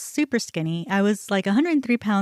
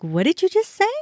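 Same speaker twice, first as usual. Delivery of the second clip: indignant confrontation; 9 semitones higher than usual. Retold or imitated. Confronting attendant after insult.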